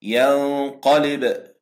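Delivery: drawn-out ينقلب with held ghunna; nasal ikhfa demo. Shown as a demonstration. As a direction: recite ينقلب slowly with the nun in the nose. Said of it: "نون ساكنة جاء بعدها حرف القاف".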